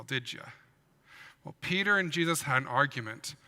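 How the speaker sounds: ordinary delivery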